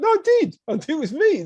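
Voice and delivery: high, insistent protest; retold indignant "I did", pitched high; insistent retelling. Insisting to doubtful paramedic.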